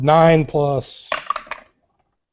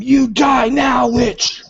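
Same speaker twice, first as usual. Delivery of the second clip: high, drawn-out war cry; raging barbarian shriek, pitched way up; battle scream; 10 semitones higher, 3 dB louder, slower than usual. Acted. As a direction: scream it slowly at the witch in a rage. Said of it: "And I just scream".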